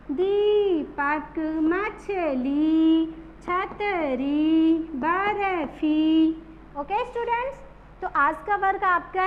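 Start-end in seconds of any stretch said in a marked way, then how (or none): 0.1-6.3 दीपक, मछली, छतरी, barfi slowly, each ee held out; stressed long ee. Shown as a demonstration. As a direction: lean on and stretch each long ee. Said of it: "जोर देकर बोलते". (बड़ी ई पर जोर, लंबी खींचकर)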